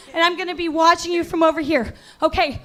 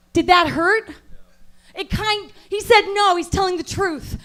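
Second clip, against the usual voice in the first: normal talking voice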